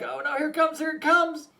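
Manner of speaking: the woman flinching as the rocks come; a hint of panic in the woman's voice; mock panic. Woman expecting the stones to hit.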